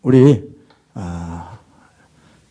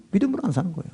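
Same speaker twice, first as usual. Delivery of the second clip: much softer murmured repeat, leaning on 안; quiet echo; 13 dB quieter, much faster than usual. Acted. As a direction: let it fall away to a murmur. Repeats the line differently. (안에 무게를 두고 훨씬 작게 중얼거리듯)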